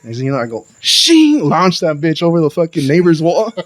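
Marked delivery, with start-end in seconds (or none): 0.8-1.5 high-pitched "shing" whoosh; shovel-fling sound. Retold or imitated. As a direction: imitate a shovel flinging its load, ringing high. Mimics flinging mess with shovel.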